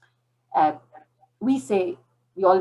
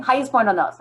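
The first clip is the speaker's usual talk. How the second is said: loud, higher, excited outburst; retold angry outburst; 7 semitones higher, 5 dB louder than usual. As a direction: blurt it out like a heated vow. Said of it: "in a fit of anger".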